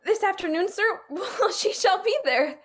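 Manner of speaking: high, giggly and delighted; delighted nurse with a chuckle; delighted laughing. Acted. Nurse delighted at wedding news.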